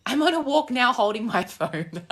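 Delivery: giggles through the words, then a high laugh. Real, not acted. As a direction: laugh at herself mid-sentence. Laughing while walking.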